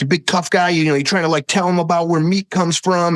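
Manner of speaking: high, mocking New York accent. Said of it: "in, like, a thick New York accent".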